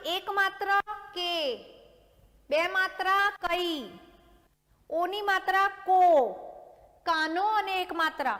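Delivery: हर मात्रा धीरे-धीरे, रुककर लिखवाते हुए; बोर्ड पर लिखते हुए धीमे गिनाओ; slow dictation. Shown as a demonstration. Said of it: "अभी वापस से हम रिपीट करेंगे". (धीमी लिखवाने वाली शिक्षिका की आवाज़)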